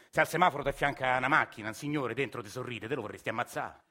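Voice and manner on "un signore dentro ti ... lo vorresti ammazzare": ordinary talk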